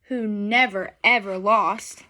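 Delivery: ordinary delivery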